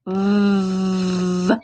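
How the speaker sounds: long steady vvv, teeth on lip; V as a sustained low-level buzz; buzzing V sound. Shown as a demonstration. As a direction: buzz the V and hold it. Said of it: "There's that V sound".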